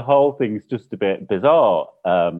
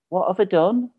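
high, baffled plea; acted-out helpless question; retold bewilderment; 11 semitones higher than usual. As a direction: ask it high, as if wronged.

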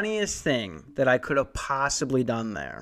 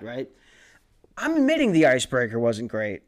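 normal talking voice

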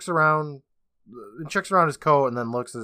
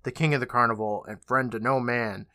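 ordinary delivery

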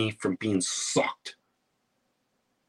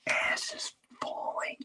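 shocked whisper; 10 dB quieter than usual. Retold or imitated. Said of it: "stage whisper of shock".

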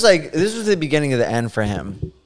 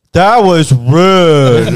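slow, louder, higher rebuke; offended client; 5 semitones higher, 9 dB louder, much slower than usual. Retold or imitated. Drunk client scolding the tattoo artist, slow offended rebuke. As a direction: let him sound slowly offended.

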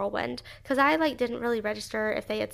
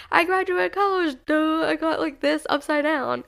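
higher, louder mock voice of her own panic; mock panicked self-talk; 5 semitones higher, 5 dB louder than usual. Grad retelling her silly fear, mock panicked. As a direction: play up the worry in a put-on voice. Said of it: "But I was just like".

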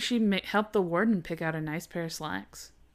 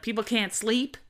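higher, louder Southern belle complaint; 4 semitones higher, 6 dB louder than usual. Retold or imitated. Talk show host complaining about fireworks, mock Southern belle. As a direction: put on an exasperated Southern belle. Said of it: "making her a Southern Belle".

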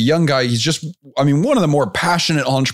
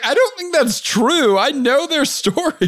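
mock disbelief; slower than usual.

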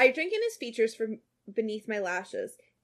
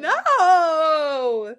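high, loud mock outrage; outraged cry; 7 semitones higher, 12 dB louder than usual. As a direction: wail in protest.

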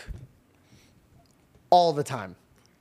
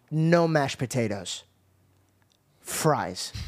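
dad's firm order; 2 semitones lower, much slower than usual. Retold or imitated. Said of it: "he looks at me and goes".

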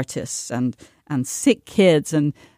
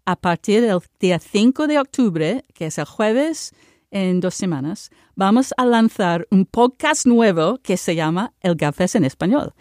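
speaking Spanish. Real, not acted. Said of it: "I just wanna speak in Spanish".

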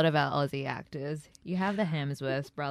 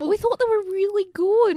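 higher and louder, mocking the clueless producers; 8 dB louder than usual. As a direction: pitch it up like naive producers proud of their work. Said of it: "It's just like".

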